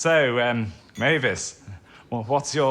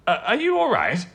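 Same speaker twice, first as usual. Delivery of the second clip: high, flustered offer of help; acted fluster at a colleague's collapse; alarmed concern; 7 semitones higher, 4 dB louder, faster than usual. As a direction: stumble over the words in sudden worry. Colleague suddenly taken ill.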